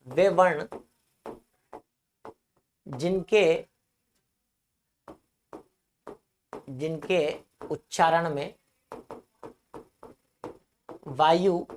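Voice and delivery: word by word with long pauses; measured dictation, waiting between phrases. Real, not acted. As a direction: dictate it slowly for note-taking. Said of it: "परिभाषा मैं लिखवा देता हूं".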